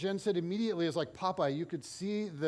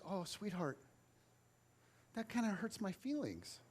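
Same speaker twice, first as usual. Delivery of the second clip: much softer, gently wounded; soft hurt; 11 dB quieter than usual. Retold or imitated. Coach softly telling a rude girl she hurt him. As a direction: let it land softly, mock hurt. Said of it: "I said".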